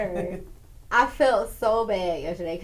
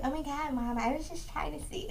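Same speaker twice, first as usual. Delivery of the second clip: soft, higher-pitched imitation of a daughter; hurt daughter; 4 semitones higher, 10 dB quieter than usual. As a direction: meekly protest as the daughter who got yelled at. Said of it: "She was like".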